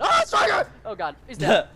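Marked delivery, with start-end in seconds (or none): 0.2-0.7 high, loud warning cry; urgent gamer's callout; alarmed shout. Real, not acted. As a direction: yell the threat out suddenly. Calls out threat in game.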